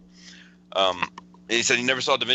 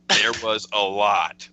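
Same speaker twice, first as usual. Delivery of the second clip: louder, drawn-out emphasis on "a lot"; all-caps emphasis; 5 dB louder, much slower than usual. Retold or imitated. Reading his friend's all-caps text aloud, slow loud emphasis on "a lot". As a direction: stretch "a lot" slowly and punch it louder. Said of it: "all caps".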